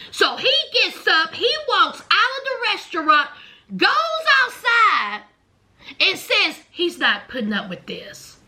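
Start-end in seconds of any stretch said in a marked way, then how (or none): none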